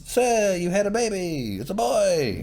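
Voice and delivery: high nervous announcer; high, eager little servant; high-pitched servant voice. Retold or imitated. Voicing the Maester announcing birth.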